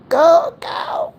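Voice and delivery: big laugh. Real, not acted. Laughing hard at her own joke about being cursed in the back. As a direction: let the laugh take over.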